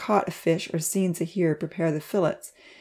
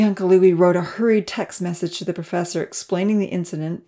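plain reading voice

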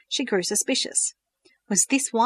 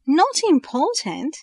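incredulous echo; 8 semitones higher, 5 dB louder, slower than usual. A narrator's reading. Verity echoes incredulously.

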